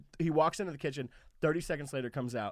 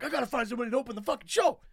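high, pushy manager voice; mimicking the frantic manager hunting for an opener; Richie impression; 11 semitones higher than usual. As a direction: rush it out like a frantic club manager. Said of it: "Great impression".

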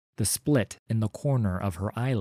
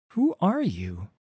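put-on higher, cautious girl's question; wary young Wreath; 8 semitones higher than usual. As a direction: ask warily, keeping distance. Wreath asks warily.

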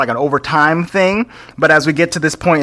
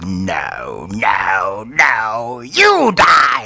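villain shout; 5 semitones lower, much slower than usual. Retold or imitated. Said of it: "the Chancellor's like".

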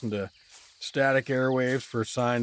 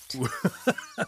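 high squealing giggle while being tickled; ticklish giggle; 9 semitones higher than usual. Acted. Doug squirms while the blue woman pokes at him, giggling. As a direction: giggle helplessly as she tickles you. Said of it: "That tickles".

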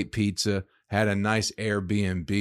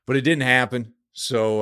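ordinary talk

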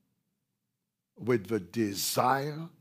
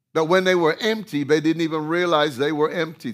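loud preaching burst; 5 semitones higher, 9 dB louder, faster than usual. Acted. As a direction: drive it home to the church. Preaching build.

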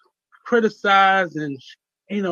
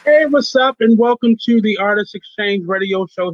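energetic radio greeting; 5 semitones higher, 8 dB louder than usual. Real, not acted. Radio host opening greeting.

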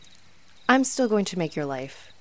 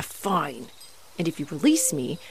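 ordinary talk, nothing marked

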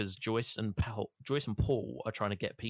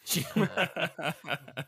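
real burst of laughter, higher pitched; 8 semitones higher than usual. Real, not acted. Player amused by Dale's swearing, laughing.

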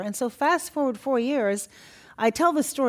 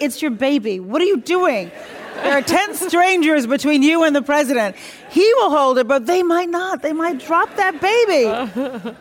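higher and louder, incredulous mock scolding; exasperated mock scolding; 7 semitones higher, 8 dB louder than usual. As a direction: scold those parents in disbelief, high and loud, slowing at the end. Imagined scolding of baby-passing parents.

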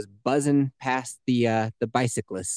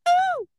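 falsetto ooh. Pretend. Falsetto after song.

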